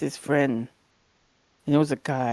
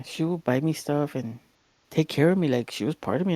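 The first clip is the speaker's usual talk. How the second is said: usual speaking voice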